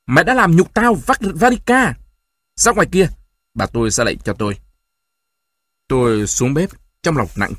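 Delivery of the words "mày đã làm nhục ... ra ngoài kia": high, loud outburst, voice pushed to cracking; furious yell. A narrator's reading. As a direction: roar at your daughter, pitch shooting up. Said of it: "bỗng ông tôi gào lên". (gào lên giận dữ, lạc giọng)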